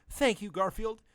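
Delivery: high, breathy-free grateful woman's voice; lightly voiced grateful woman. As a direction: thank your rescuer in a high voice. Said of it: "said Oriana with gratitude".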